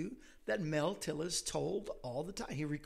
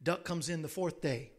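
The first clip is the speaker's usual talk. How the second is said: ordinary talk, nothing marked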